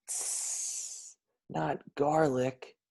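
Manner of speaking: a breathy hiss, then a slow recoiling no; hissed disgust. A narrator's reading. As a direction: recoil from the word garlic. Vampire recoils from garlic.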